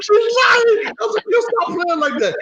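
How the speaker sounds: high, frantic pleading; desperate denial. Acted. Accused man protests to angry husband, desperate. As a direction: protest wildly at the top of your range.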